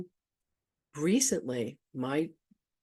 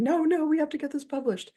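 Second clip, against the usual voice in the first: mock high-pitched frantic protest; freaking out, imitated; 13 semitones higher, 4 dB louder than usual. Retelling friend's deadline panic, high mock freak-out. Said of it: "Lynn was freaking out going".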